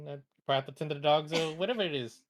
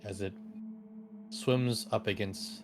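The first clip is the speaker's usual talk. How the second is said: game master narration; 6 semitones lower than usual. Acted. DM narrates eerie scene.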